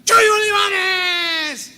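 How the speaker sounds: very high, loud, drawn-out shriek; grito de señora. Retold or imitated. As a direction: imitate a woman yelling across a plane. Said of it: "empieza a gritar". (grito agudo y alargado de señora entusiasmada)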